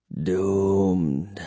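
low, soft vampire voice; hushed vampire gloom. A narrator's reading. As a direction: breathe it out as a quiet verdict. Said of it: "he whispered".

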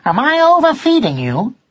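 high, innocent question; high-pitched naive query; young cat. A narrator's reading. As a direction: ask it in a high young cat's voice. Son cat asks his pet mouse.

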